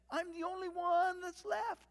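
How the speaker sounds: high, loud mock whine; hammed-up self-pitying wail; complaining. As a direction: complain loudly, feeling sorry for yourself. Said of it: "what is it he complained to God?".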